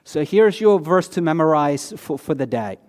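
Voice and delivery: ordinary talk, nothing marked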